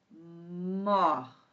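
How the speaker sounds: "mort" with a long hummed M; drawn-out dictation. Shown as a demonstration. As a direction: hold the M of "mort", then land the word. Sounding out word while writing.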